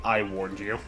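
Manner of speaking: low, hollow ghostly badger warning; a lightly voiced dead badger, low and grim; skeletal badger voice. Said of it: "a skeletal badger saying".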